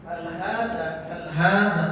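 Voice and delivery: loud, clear Arabic drill; loud teacher drill; loud model reading. Shown as a demonstration. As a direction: call it out for the class to repeat. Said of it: "teacher models phrase for class".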